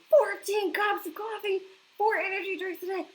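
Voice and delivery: stunned disbelief. Real, not acted. Reading a viewer's caffeine count aloud in stunned disbelief. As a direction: read it out as if you can't believe it.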